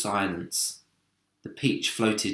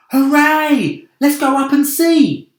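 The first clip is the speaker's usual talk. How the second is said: loud high joyful cheer; 13 dB louder than usual. Acted. Travellers cheering their escape, loud joyful cheer. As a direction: shout it with relief.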